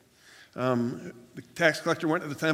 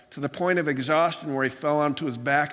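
ordinary talk

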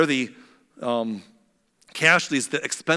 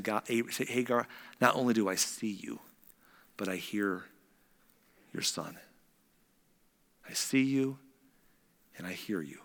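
God reassuring Hagar, soft and tender; tender God's voice; 4 semitones lower, 8 dB quieter than usual. Acted. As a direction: speak comfort gently.

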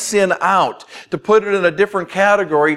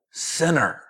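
"sinner" alone, softer but weighted; emphasis on sinner; 6 dB quieter than usual. Shown as a demonstration. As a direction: drop "sinner" quietly, like a judgement. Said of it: "we say it with that emphasis".

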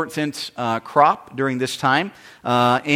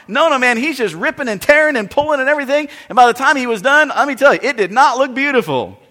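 excited storytelling; 7 semitones higher than usual. Real, not acted. Animated retelling of the pruning.

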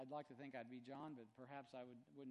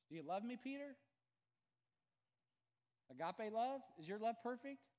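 higher and louder pleading question; Jesus asking Peter, raised; 8 semitones higher, 8 dB louder than usual. Retold or imitated. Jesus presses Peter about his love, raised pleading. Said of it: "he's just basically gonna ask Peter".

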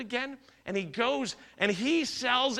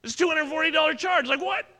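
high, loud, baffled outburst; retelling his shock at a surprise school fee, loud and high; indignant surprise; 7 semitones higher, 6 dB louder than usual. Retold or imitated. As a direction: act out being shocked at the charge. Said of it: "I'm like".

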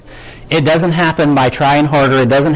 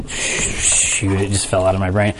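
low, soft self-talk; real flustered aside; 7 semitones lower, 6 dB quieter, slower than usual. Real, not acted. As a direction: drop the voice, talking to yourself while blanking. Loses his quote, aside to himself.

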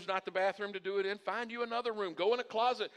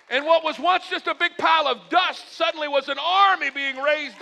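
high, loud sermon climax, mounting phrase by phrase; preaching building to shouts; 9 semitones higher, 12 dB louder than usual. Acted. Pastor telling of bones rising as an army, building to shouts. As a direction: build to a thundering peak, dropping on the last words.

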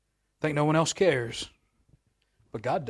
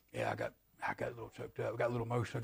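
mumbled, much softer, quick brush-off; embarrassed man; 5 semitones lower, 16 dB quieter, much faster than usual. Retold or imitated. A man hiding tears, mumbling a soft fast excuse. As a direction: play it off like it's nothing. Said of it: "men don't cry".

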